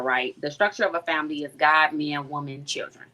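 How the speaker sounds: usual speaking voice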